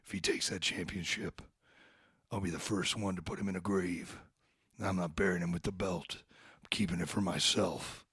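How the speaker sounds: deep villain voice cutting a threatening wrestling promo; menacing threat voice. Shown as a demonstration. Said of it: "Let me say it like this".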